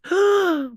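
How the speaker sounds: big, high-pitched dramatic gasp; sharp acted intake of breath; sudden gasp. Kat all of a sudden realizes something, dramatic gasp.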